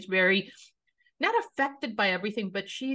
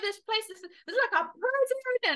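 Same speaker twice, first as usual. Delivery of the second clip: put-on a middle child storming off; whiny teen rant; 14 semitones higher than usual. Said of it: "the middle child is like".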